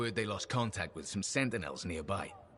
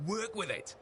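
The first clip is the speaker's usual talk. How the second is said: mild, higher-pitched mock yell; retold yell; 9 semitones higher than usual. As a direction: call it out higher, without real volume. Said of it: "so I yell".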